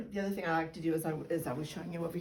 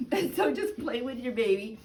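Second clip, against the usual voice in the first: ordinary delivery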